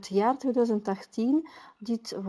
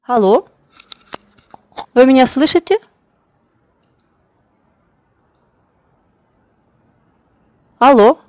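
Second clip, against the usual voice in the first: calling out; 4 semitones higher, 14 dB louder than usual. Real, not acted. Checking if audio works.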